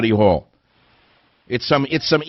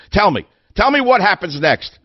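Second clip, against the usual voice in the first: demanding shout; 8 semitones higher than usual. Real, not acted. Radio host shouting a challenge at his listeners. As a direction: hammer the question at the audience.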